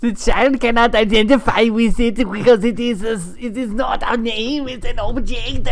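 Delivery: exaggerated German accent. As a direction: read it like a stern German official. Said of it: "That's my level best German accent".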